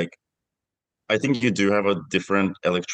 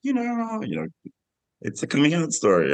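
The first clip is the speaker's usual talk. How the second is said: polite condescension; 10 semitones higher than usual. Retold or imitated. Director mimicking how critics write about his film, politely condescending. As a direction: imitate patronising reviewers with exaggerated politeness. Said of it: "it's almost like a polite, condescending".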